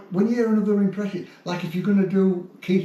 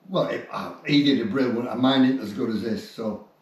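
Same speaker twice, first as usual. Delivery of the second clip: ordinary delivery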